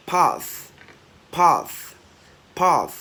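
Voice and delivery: "path" high and loud with an airy ending; emphatic drill of "path"; loud released TH. Shown as a demonstration. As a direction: punch "path", then let the TH hiss. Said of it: "bật càng to thì càng hay".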